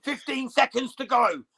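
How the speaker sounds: live play-by-play. Real, not acted. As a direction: call the last seconds of the round live. Live round-end commentary.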